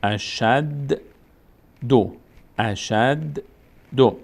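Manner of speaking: "اشد دو" split in two, the د closed and then released; teacher's syllable demo of a doubled د in "اشد دو"; doubled consonant. Shown as a demonstration. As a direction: sound out "اشد", pause, then a separate "دو". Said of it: "یک بار به صورت ساکن".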